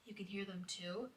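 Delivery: very soft, disbelieving question; quietly acted question; hushed question. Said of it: "And then I'm like".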